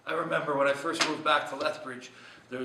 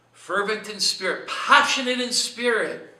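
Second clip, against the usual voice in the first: loud, high, impassioned proclamation; fervent preaching; 7 semitones higher, 11 dB louder than usual. Real, not acted. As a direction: raise the voice with passion, building louder and higher. Preacher enacts the verse's fervour.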